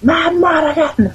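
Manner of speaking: high-pitched squeaky character impression; Willow. Shown as a demonstration. Podcaster attempting a film character for the co-host, high-pitched impression. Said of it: "I would do a Willow impression".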